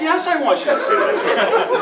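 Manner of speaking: high, loud, quick comeback; emphatic insistence. Retold or imitated. Lawyer ordering colleague to relay threat, comically insistent. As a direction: insist on it, pitched right up. Acted insistent reply in retelling.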